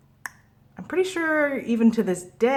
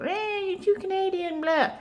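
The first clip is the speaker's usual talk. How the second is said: very high, silly heckler voice; mocking complainer; 13 semitones higher than usual. People objecting to a Canadian accent, mocked in a high voice. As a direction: squeak out a mock complaint.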